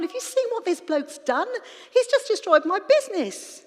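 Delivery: loud, high-pitched outraged complaint; indignant herdsman. Acted. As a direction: tell everyone your livelihood is ruined. Herdsman complaining as news spreads.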